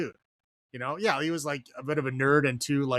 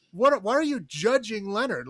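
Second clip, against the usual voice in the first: higher, exasperated challenge; indignant, pitched-up questioning; 8 semitones higher than usual. Acted. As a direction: demand an answer in disbelief. Voicing exasperation at the movie character.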